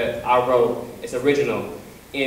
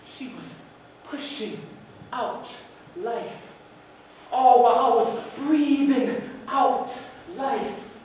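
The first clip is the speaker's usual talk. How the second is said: soft, high, broken into single words; halting, hushed lines with long pauses; spoken-word performance; 10 semitones higher, 3 dB quieter, slower than usual. Acted. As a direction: pause between words as if labouring.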